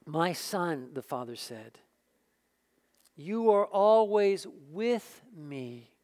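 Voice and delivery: calm a hurt son gently; tender father. Acted. Father gently answers angry son.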